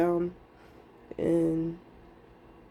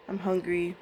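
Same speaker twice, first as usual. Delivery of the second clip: ordinary talk, nothing marked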